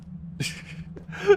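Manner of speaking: high airy giggle; quick real giggle; breathy laugh. Real, not acted. Laughing at own mistake.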